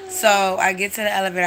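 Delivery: normal talking voice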